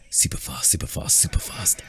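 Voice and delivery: whispered self-chant; hushed, repeated self-encouragement; under the breath. A narrator's reading. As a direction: mutter it voicelessly to yourself. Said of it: "You repeat under your breath".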